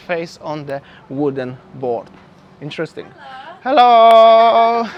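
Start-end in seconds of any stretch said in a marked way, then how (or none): 3.6-4.9 a big, ringing street hello; loud hello. Real, not acted. Greeting a passerby on the lane, loud and bright. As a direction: hail a passerby loudly, then laugh.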